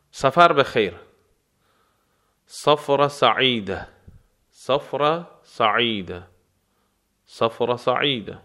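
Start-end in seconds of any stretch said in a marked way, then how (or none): 2.4-6.3 slow model phrase. Shown as a demonstration. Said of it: "teacher models phrase slowly".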